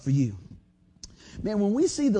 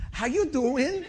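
higher, chummy greeting; dad's greeting; 6 semitones higher, slower than usual. Retold or imitated. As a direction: greet the cashier like old friends. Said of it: "My dad goes".

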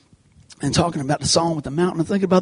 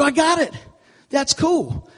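much higher, lit-up eureka; excited realization; 10 semitones higher, slower than usual. Retold or imitated. Preacher reliving the moment the verse clicked, excited. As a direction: sound like it just clicked. Sudden insight retold.

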